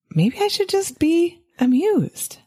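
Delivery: high, slow, wry idea; dawning realization. Pretend. Tired parent watching kids fight, slowly realizing with a high voice. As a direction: let the idea land slowly.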